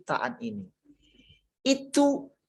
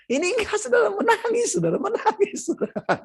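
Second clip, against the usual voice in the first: laughing disbelief. Real, not acted. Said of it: "Saya ketawa".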